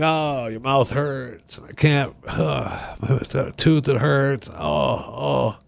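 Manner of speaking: soft, slow mock moaning; mock whining. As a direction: whimper like a sulky complainer. Mocking whiner with toothache.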